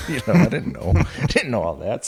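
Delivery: laughing through the words at his own naivety; chuckling as he admits it. Real, not acted. Telling the host he never knew, laughing. Laughing about not knowing résumés.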